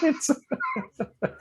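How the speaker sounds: a shrill burst of real laughter, way up high; helpless high-pitched giggle fit; high squealing laugh. Real, not acted. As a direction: lose it completely and squeal. Cracking up at partner.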